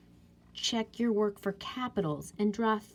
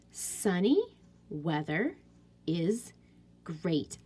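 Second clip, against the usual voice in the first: normal talking voice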